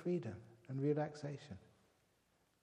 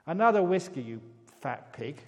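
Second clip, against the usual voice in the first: loud, higher rude shout; shouted insult; 3 semitones higher, 11 dB louder than usual. Retold or imitated. Parrot shouting at the hostess for another whiskey, loud. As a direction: yell the order like a drunk bully. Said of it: "shouted at the".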